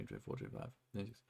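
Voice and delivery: counting under the breath, fading to a whisper. Real, not acted. Streamer counting browser tabs to himself, whispering. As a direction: mutter the numbers to yourself almost silently.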